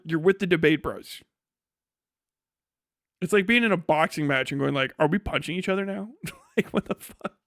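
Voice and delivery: ordinary delivery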